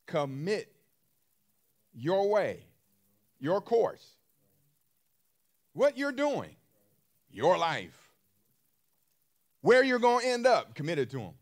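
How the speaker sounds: rising to a loud, high preaching cry; building sermon. Acted. Preacher urging the congregation to commit their way, building to a loud cry.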